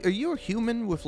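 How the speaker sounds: usual speaking voice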